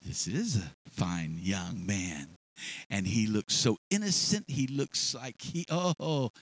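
low, slow, lightly voiced temptress; a hint of a lingering, admiring wife; understated temptress eyeing him; mild seductive wife. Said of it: "And she saw".

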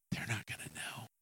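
hushed conspiratorial whisper; secret whisper. Acted. [name] whispering to himself about his secret.

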